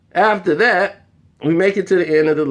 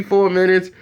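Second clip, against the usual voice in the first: ordinary delivery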